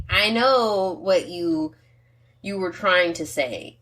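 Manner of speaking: put-on slow, deliberate parent to her teen; slow patient mom. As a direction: spell it out slowly to the daughter. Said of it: "And I'm like".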